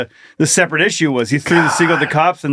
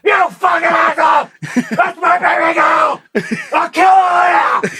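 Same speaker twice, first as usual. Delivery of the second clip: raging dad; 11 semitones higher than usual. Acted. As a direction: scream threats like an unhinged protective father. Acting video of raging dad.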